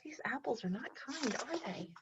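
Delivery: quiet aside about unkind apples; hushed aside. Real, not acted. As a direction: lean in and murmur it to the class. Teacher's hushed aside to class.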